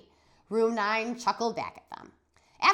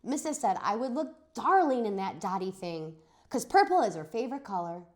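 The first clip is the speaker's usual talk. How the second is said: ordinary delivery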